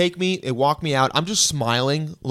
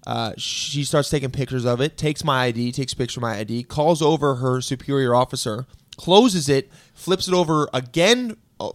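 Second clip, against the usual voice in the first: normal talking voice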